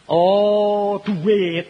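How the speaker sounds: loud, high "oh, money" of realization; realizing. Retold or imitated. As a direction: it suddenly dawns on you, loud. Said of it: "aku bilang gitu". (nada tersadar yang tinggi dan keras)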